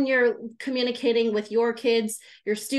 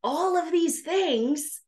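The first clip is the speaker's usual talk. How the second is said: whisper; 5 semitones higher, slower than usual. Shown as a demonstration. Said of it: "whispered emphasis".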